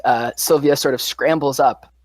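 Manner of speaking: usual speaking voice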